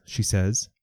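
ordinary delivery, nothing marked

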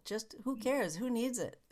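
ordinary talk, nothing marked

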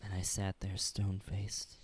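low whispered deadpan. Real, not acted. Co-host whispering dryly that he didn't laugh. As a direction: whisper it flatly.

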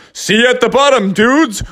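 high, breezy dude call; father bear calling to family; cool father bear. Acted. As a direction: toss it off before jumping. Dad jumping off slide.

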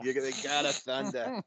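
put-on Odin's line in a Boston accent. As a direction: say it like a Boston guy. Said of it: "I don't know why I did that to Odin".

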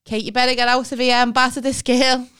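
loud, pushy nan cheering her into a fight; loud urging. Retold or imitated. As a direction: bold grandmother urging loudly.

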